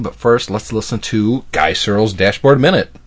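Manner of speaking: usual speaking voice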